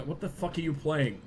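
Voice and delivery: ordinary talk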